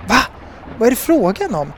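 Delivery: lightly acted bafflement, pitch jumping up; mild exclamation. A narrator's reading. Sol peering back for the constellation, mildly baffled exclamation. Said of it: "Till sist utropade hon".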